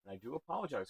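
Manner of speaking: usual speaking voice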